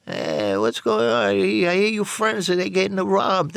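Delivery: put-on Italian accent. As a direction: put on the cousin's thick Italian accent, drawn out. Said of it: "with this greaseball accent".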